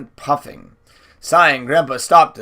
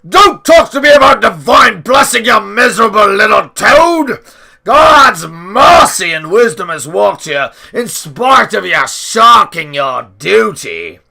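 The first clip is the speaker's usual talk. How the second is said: loud, much higher furious shout; snapping furiously; 6 semitones higher, 10 dB louder than usual. A narrator's reading. Grandpa snapping at the little man, furious shout. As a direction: spit the insult, slowing at the end. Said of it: "Grandpa snapped".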